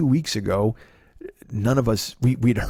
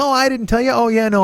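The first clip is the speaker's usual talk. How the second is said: much higher, a bit louder mom voice; imitating mom; 12 semitones higher, 4 dB louder than usual. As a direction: toss it off high like mom shrugging. Mom voiced, casual confession.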